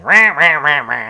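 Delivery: loud squawk that fades away; Penguin squawk. Retold or imitated. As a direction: bark rah like an old cartoon villain. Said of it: "where he's just like".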